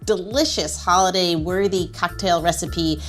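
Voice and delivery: ordinary talk, nothing marked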